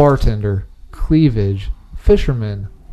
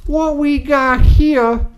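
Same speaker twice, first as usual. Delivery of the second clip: higher, slow Southern warden voice; Southern drawl; 9 semitones higher than usual. Acted. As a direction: drag out a Southern lawman accent. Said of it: "that accent".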